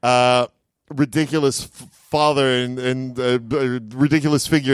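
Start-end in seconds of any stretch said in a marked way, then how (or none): none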